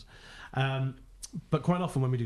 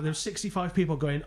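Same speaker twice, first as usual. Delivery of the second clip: ordinary talk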